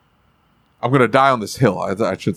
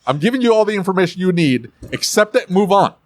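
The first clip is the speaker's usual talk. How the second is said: firm, higher-pitched authority; dad voice; 6 semitones higher than usual. Shown as a demonstration. Shop owner ending a customer's argument, assertive dad voice. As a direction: shut the argument down. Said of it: "have to put your dad voice".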